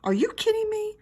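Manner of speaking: rising high screech of disbelief. A narrator's reading. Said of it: "I raised my screech even louder".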